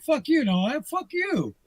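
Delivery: high-pitched imitation of a furious woman, without shouting; mock losing it. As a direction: mimic her outraged outburst, pitch leaping up. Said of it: "and she lost her shit".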